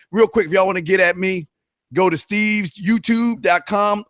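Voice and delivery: normal talking voice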